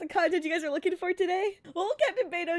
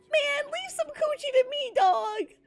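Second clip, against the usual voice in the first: high, hammed-up sobbing whine; mock crying plea; 8 semitones higher than usual. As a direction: wail it like a desperate, overdramatic beggar. Sailor begging for a share.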